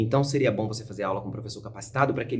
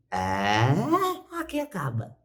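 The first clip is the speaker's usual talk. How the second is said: student voice; 4 semitones higher, much slower than usual. Retold or imitated. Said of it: "Eu sinto que eu não tenho adução aqui".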